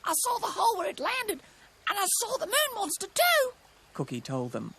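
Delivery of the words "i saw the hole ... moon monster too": high, urgent bird voice; alarmed cartoon bird; frightened kookaburra. A narrator's reading. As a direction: report it in a high scared voice.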